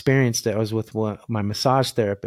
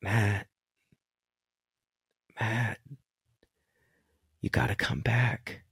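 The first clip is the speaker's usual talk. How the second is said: gently calls him back, much softer; quiet, coaxing call to come back; 8 dB quieter than usual. Retold or imitated. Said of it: "Ken goes".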